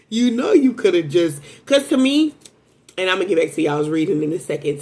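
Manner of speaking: ordinary talk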